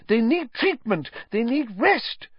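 high-pitched, flustered protest; spluttering protest. A narrator's reading. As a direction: object in high-voiced indignation. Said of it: "spluttered Madam Pumphrey".

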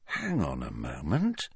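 low, quiet, slowly dawning thought; thinking aloud, low and soft; slow, pondering. A narrator's reading. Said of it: "he said slowly".

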